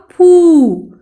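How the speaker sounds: फू loud with a long ऊ; pronunciation demo. Shown as a demonstration. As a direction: hold the vowel of फू. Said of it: "उच्चारण कैसा होगा?".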